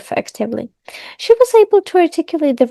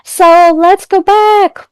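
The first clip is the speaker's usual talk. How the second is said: cheerful call-back; 5 semitones higher, 6 dB louder, slower than usual. Real, not acted. Returning from promo, drawn out.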